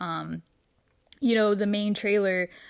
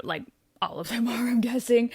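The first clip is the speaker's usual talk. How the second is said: whisper; 4 semitones higher than usual. Real, not acted. Aside about the cast attending.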